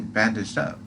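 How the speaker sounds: ordinary delivery, nothing marked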